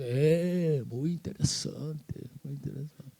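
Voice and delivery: soft, slow, skeptical murmur; mock the family's polite disinterest; unimpressed brush-off. Family shrugs off his vision.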